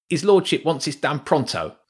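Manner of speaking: high, lightly voiced workman rat; a rat worker passing on the boss's orders. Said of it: "said the first voice".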